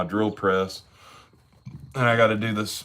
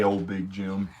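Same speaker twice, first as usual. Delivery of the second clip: Jim's way of saying it; 2 semitones lower, 7 dB quieter, slower than usual. Retold or imitated. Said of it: "that's how Jim says it on his video".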